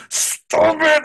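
pleading hush. Retold or imitated. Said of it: "And he goes".

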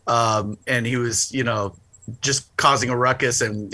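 usual speaking voice

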